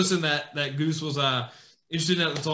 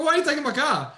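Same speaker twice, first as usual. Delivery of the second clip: higher, mock indignant question; a touch of retold anger at the kid; 9 semitones higher than usual. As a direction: demand an answer, half-laughing outrage. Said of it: "I go".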